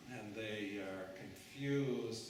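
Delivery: normal talking voice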